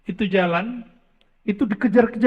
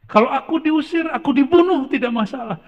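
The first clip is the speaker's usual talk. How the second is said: raised, high pleading cry; impassioned plea; 6 semitones higher than usual. Acted. Abu Bakar pleading with the Prophet, raised and high. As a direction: plead high and urgent, as if near tears.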